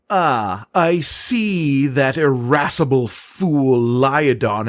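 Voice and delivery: slow, loud haughty duchess over a loudspeaker; imperious plummy grande dame. Acted. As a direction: sneer down at them from above. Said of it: "with the Duchess Rose's voice pipes through".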